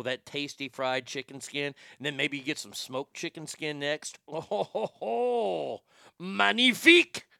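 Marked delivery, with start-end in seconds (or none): 4.3-5.8 chef's chuckle. Acted. Chef savoring chicken skin. 6.2-7.3 hammed-up French chef exclamation; proud chef, drawn out and loud. Chef savoring chicken skin.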